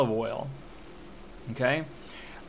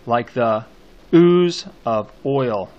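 loud, drawn-out stress on "ooze"; poem scansion reading; 9 dB louder, slower than usual. Shown as a demonstration. Teacher scanning the poem for students, slow emphatic recitation. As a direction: lean hard and long on "ooze", then "oil".

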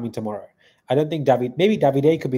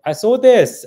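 much higher and louder exclaim; high, loud mock shout of a viewer's comment; excited outburst; 10 semitones higher, 10 dB louder, slower than usual. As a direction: blurt it out like shocking news. Reading chat comment loud.

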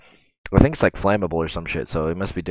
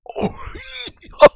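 high shriek laugh. Real, not acted. Shocked by a clip.

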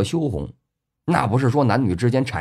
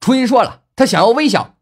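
high, loud cupped-hands holler; loud shout; 11 semitones higher, 6 dB louder than usual. A narrator's reading. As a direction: shout it out to the open sky. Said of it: "大声喊道".

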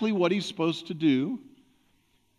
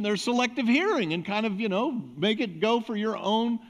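ordinary talk, nothing marked

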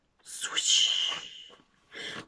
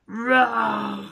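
dinosaur roar. Acted.

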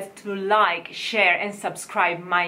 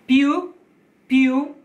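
più with a loud stressed u, higher; 6 semitones higher, 6 dB louder than usual. Shown as a demonstration. Coach stressing più for singers, loud. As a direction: punch the u of più. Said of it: "has a stress on the U".

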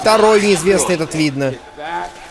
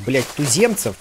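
usual speaking voice

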